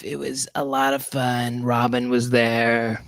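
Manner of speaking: slow, laid-back surfer drawl; Whoopi Goldberg impression. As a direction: draw it out like Whoopi. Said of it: "She sounds like this".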